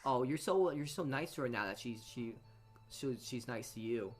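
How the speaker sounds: normal talking voice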